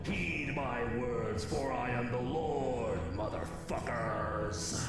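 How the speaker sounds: mock biblical prophecy. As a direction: parody of God speaking in a hushed, grave tone. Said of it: "prophesized in the Book of Revelations".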